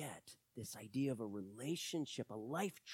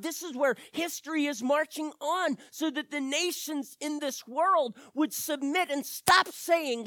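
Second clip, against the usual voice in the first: high, loud, ringing pulpit voice; preaching at full force; 11 semitones higher, 10 dB louder than usual. Acted. Preacher proclaims God's promise to the nations, high and loud. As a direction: preach it out with rising fire.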